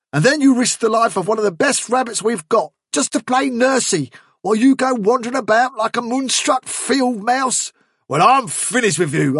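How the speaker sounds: high-pitched, sustained angry tirade; furious. A narrator's reading. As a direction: rant at him, building to a final warning. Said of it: "turned furiously on Fiver".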